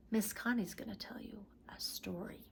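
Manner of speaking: soft secret whisper to the class. Real, not acted. Teacher whispers to children that story time is coming, hushed.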